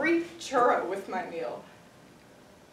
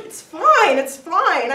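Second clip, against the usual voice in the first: loud, higher, insistent brush-off; hasty reassurance; 5 semitones higher, 7 dB louder, much slower than usual. Acted. Customer to crying cashier, insistently reassuring. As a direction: wave away the apology, raised voice. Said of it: "And I'm like".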